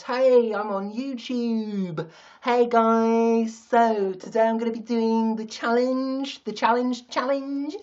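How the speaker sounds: mock an upbeat YouTuber in a London accent. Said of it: "in London I talk like that".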